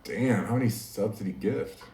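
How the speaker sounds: ordinary talk, nothing marked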